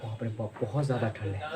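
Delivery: low, hushed complaint of cold; soft, low mock shiver; acting cold. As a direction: grumble softly as if freezing. Acts out freezing cold.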